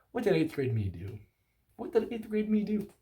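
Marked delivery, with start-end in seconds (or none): 1.8-2.9 high, silly voice for the question; a cartoonish high-pitched retake; squeaky put-on voice. Shown as a demonstration. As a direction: ask it again in a goofy high voice. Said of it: "repeated in a put-on voice".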